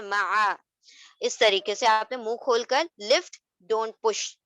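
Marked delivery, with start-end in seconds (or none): none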